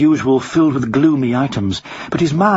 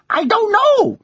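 very high parrot voice; parrot character; slower than usual. Acted. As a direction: answer as a high-pitched talking bird. Parrot answers the policeman.

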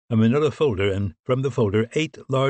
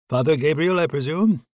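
higher-pitched, lightly played British voice; British accent; 7 semitones higher than usual. A narrator's reading. British officer answering his colleague's late call, in a British accent. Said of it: "pronounced British accent".

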